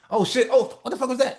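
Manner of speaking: high, loud mock shock at tripping over money; startled surprise. Man who tripped into a million dollars, startled yelp. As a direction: yelp it like you just tripped on cash. Stumbling onto a pile of cash.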